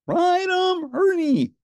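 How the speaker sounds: high, loud yee-haw call; cowboy whoop. Acted. Ernie cheering on the raft ride, whooping loudly. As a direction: whoop it up like a rodeo rider.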